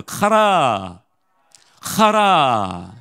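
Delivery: each word very drawn out, falling; word demonstration. Shown as a demonstration. Preacher teaching congregation the word for anger, slowly stretched.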